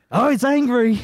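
put-on high-pitched joke Australian accent; bad Australian accent. Show host remarks on the poked monster, bad Australian accent. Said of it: "don't do a really good Australian accent".

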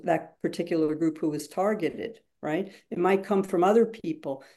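usual speaking voice

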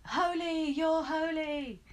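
high, drawn-out call without real volume; mock taunting shout. Kids calling to the cheese across the road, mock shout. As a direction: jeer it in a long, high sing-out. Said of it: "they shout at him".

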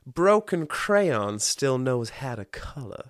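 slow, deliberate mock-profound line; slow solemn affirmation. Reading out a favourite affirmation, slow and mock-profound. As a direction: savour it like a wise saying. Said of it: "the other one that I had".

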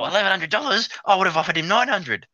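high, indignant mock whine; whinging buyer's wife. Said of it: "And she just kept whinging".

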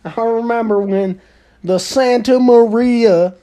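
hammed-up ancient tree recalling the past; old tree. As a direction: reminisce grandly like an ancient tree. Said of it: "see their grandparent trees".

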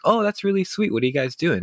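put-on high, bright-eyed girl voice; sweet girl voice. Girl charmed by a story about a cousin, high sweet voice. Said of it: "she'd be like".

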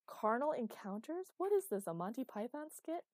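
mock outrage at the wording; incredulous outburst. A narrator's reading. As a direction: scoff at the prose. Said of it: "Interrupted Ryan".

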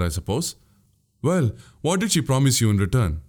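ordinary delivery, nothing marked

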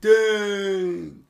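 loud, high burst of excitement; excited shout. Real, not acted. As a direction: react like it's huge news. Excited reaction to news.

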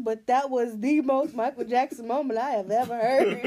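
loud, laughing throughout. Real, not acted. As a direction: laugh while teasing. Laughing loudly.

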